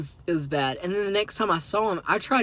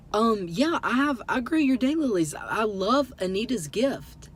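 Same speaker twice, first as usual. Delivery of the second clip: higher-pitched, gushing retold fan talk; starstruck; 5 semitones higher than usual. Starstruck fan complimenting a famous daylily hybridizer, gushing. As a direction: imitate yourself gushing to an idol. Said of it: "in starstruck".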